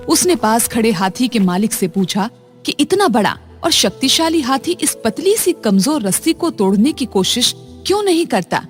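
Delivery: ordinary delivery, nothing marked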